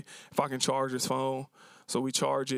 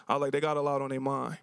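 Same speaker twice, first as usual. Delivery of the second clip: ordinary talk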